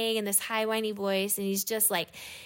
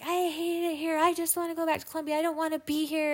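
high-pitched imitation of a kid's tantrum; retold fit; 8 semitones higher than usual. Woman retelling a child's big fit in the car, high mock tantrum. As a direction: mimic a child's protest, pitched way up. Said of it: "goes into her big fit".

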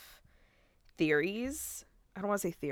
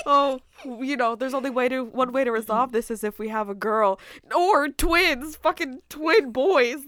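excited retelling; 7 semitones higher, 7 dB louder than usual. Retold or imitated. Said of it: "When she said".

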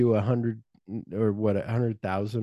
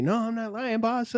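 mock pleading henchman; 12 semitones higher than usual. Mocking imagined dramatic exchange.

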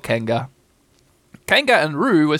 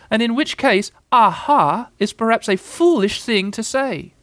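high, fussy little animal voice; anxious Piglet; 8 semitones higher, 4 dB louder than usual. A narrator's reading. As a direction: argue nervously in a much higher voice.

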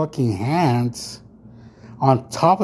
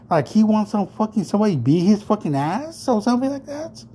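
high, heated venting; real exasperated rant; 7 semitones higher than usual. Real, not acted. Telling viewers about the restroom guy, high heated rant. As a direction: vent your disbelief, pitched up. Ranting about the rude stranger.

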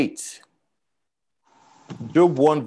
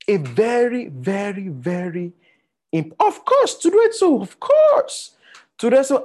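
rising to a very high excited cry; excited high emphasis; 8 semitones higher, 3 dB louder than usual. Real, not acted. Preacher stressing Satan's important work, excited high cry. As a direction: let the excitement burst out high.

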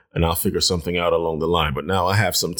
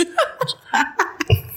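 high, helpless laughing fit; real high-pitched laugh; burst of laughter. Real, not acted. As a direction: crack up laughing mid-story. Laughs mid-story.

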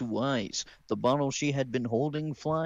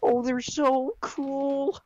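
put-on very high, bubbly girl voice; squeaky excited young girl; Ruby voice; 4 dB louder, slower than usual. Reader voicing Ruby.

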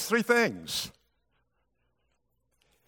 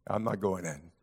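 sullen refusal; 9 semitones lower, 7 dB quieter than usual. Acted.

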